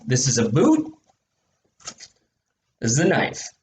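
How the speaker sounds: gruff marine voice. Acted. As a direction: play a tough marine.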